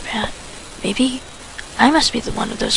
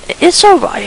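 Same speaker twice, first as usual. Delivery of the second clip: forced cheer; 7 semitones higher, 7 dB louder than usual. Acted. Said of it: "I forcibly said cheerily".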